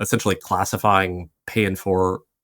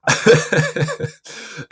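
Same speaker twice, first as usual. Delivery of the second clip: laughter; 11 semitones higher, 3 dB louder than usual. Real, not acted. Laughing at the joke.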